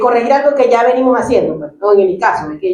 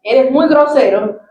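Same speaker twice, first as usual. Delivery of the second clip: scolding example; slower than usual. Shown as a demonstration. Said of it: "en vez de decir".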